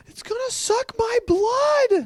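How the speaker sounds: hammed-up panicked shriek; screaming girls. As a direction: shriek it like a scared kid. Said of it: "They were like".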